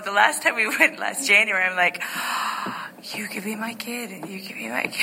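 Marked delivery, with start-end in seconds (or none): 2.1-3.1 quick startled unvoiced gasp; sharp gasp. Shocked at young pastors. 3.1-4.9 much softer, half to herself; muttered aside. Retold or imitated. Private thought about young pastors.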